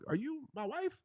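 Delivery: high-pitched comic disbelief; mock double take, voice way up; retold shock. Husband not recognizing his swollen wife, acted disbelief.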